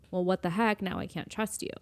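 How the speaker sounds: normal talking voice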